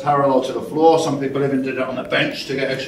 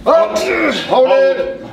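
coach shouting cues; 11 semitones higher, slower than usual. Real, not acted. Coach cueing a lift.

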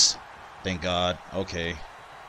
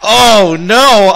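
alarmed; 4 semitones higher, 6 dB louder than usual. Real, not acted. Reversal coming.

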